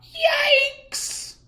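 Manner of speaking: very high startled yelp. A narrator's reading. Joey Mackerel startled by the swing, high yelp. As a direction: jump at it. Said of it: "cried Joey Mackerel".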